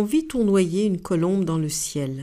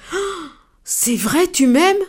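much higher, slow, marvelling; exclaiming with joy; 9 semitones higher, 5 dB louder, slower than usual. Acted. The elephant, thrilled by the dove, exclaims high and slow. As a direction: let the joy burst out slowly. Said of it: "L'éléphant s'exclama".

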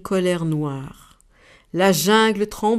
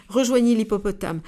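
plain reading voice

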